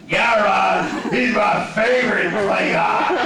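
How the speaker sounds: loud, high, a little slow; Worthy loudly raving that Gowron is his favorite; excited shout. Retold or imitated. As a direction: shout your favorite with delight. Worthy enthusing about Gowron.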